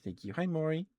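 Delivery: normal talking voice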